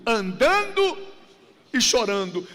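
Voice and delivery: "andando" high and loud, "e chorando" dropping; stress on andando. Shown as a demonstration. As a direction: lean hard on "andando", then let "e chorando" fall. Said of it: "por que essa ênfase".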